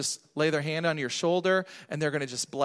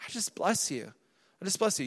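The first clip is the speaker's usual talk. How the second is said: softer, simple blessing; soft, unpretentious blessing; gentle blessing; 7 dB quieter than usual. Shown as a demonstration. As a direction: say it gently over someone. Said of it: "You just say".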